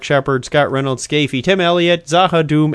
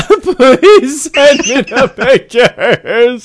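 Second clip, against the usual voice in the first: mock crying; 11 semitones higher, 8 dB louder, much slower than usual. Mock crying plea for pictures.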